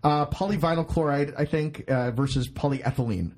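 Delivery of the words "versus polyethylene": ordinary talk, nothing marked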